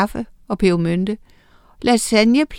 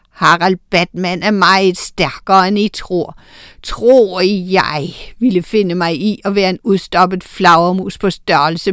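gloating villain; 4 dB louder than usual. Acted. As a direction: swell into a villain's threat. Bat villain Harald Batman threatens. (lav, truende skurkestemme der vokser)

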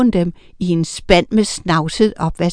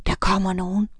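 soft, hushed warning; whisper; 6 dB quieter than usual. A narrator's reading. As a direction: whisper that danger is coming. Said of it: "hviskede han".

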